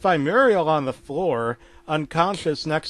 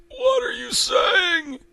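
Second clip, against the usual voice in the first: very high, drawn-out cry; Eustace distraught; slower than usual. Acted. Eustace hearing the doctor cannot help, distraught. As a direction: cry out in disbelief, high and slow.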